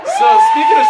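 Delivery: booming high segue shout; loud showman's transition; big shout. Real, not acted. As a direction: announce it to the whole room.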